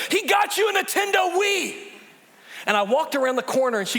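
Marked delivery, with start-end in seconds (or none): 0.1-1.8 put-on high shouted blurt of a little girl; a four-year-old blurting the secret to her brother, shouting; mock child's blurting shout. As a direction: shout it out like a kid spilling the secret. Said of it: "she goes".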